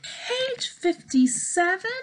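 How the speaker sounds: high, anxious page fifty-seven; alarmed question. Acted. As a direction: rise in worry. Gerald alarmed.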